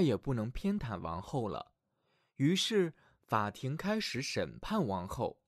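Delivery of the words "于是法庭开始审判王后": ordinary delivery, nothing marked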